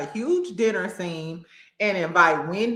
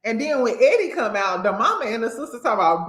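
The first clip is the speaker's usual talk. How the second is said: normal talking voice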